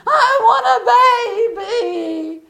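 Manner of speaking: loud, very high mock bawling; hammed-up wailing through tears; imitated crying wail. Young girl wanting a baby, imitated bawling. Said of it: "what are you crying about".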